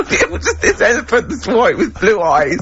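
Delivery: talking through real laughter; can barely get the words out for laughing. Real, not acted. Laughing at the story.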